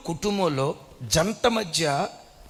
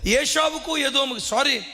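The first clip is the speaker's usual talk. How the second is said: higher, pressing sermon delivery; impassioned preaching; 7 semitones higher than usual. Acted. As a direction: warn the congregation urgently. Preaching at raised pitch.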